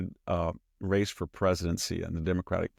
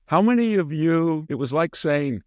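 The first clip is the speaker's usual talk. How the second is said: higher mock game-show question; sing-song the loaded question; mocking poll question; 7 semitones higher, 4 dB louder than usual. Mocking the convention poll as a loaded quiz, higher voice. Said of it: "Jonathan Capehart said".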